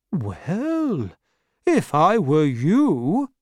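put-on slow, considered old man; Mister Dick giving advice; Mister Dick advising. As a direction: weigh it carefully.

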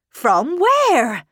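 high, loud, incredulous query; startled question. A narrator's reading. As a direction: demand an answer in alarm. Housekeeper startled by the news.

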